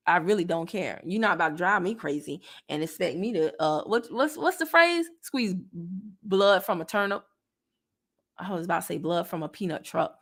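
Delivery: ordinary delivery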